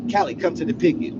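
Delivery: loudspeaker call. Retold or imitated. Guard paging him to the picket over the loudspeaker. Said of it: "called me on the loudspeaker".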